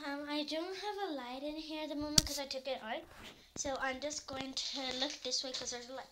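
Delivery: ordinary delivery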